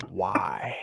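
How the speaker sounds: unvoiced, soft whispered why. Real, not acted. Asking friend why, in a whisper. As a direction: whisper it close and pleading.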